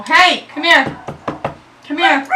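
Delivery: high, loud shout; yelling. Real, not acted. Owner yelling at barking dogs mid-video, real yelling. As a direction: call the dog in at full volume. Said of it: "I'm so sorry for yelling".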